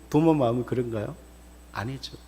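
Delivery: ordinary talk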